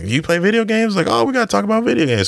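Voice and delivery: high-pitched, eager gamer chatter; excited retelling. Retold or imitated. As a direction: pitch leaps up, rushing with enthusiasm. Acts out excited meeting over games.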